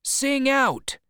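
very high crew cry. Acted. Crew answering their captain, high-pitched cry. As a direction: call it out high and long. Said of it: "cried the crew".

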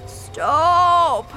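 loud, high, drawn-out yell; urgent storybook shout. A narrator's reading. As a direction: shout to halt it at once. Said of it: "shouted Grandpa Joe".

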